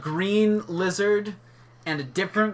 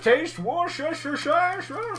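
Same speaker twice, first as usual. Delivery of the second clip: lightly voiced mock sob story; mock tragic lament; 10 semitones higher than usual. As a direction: play it as a hammy tragic memory.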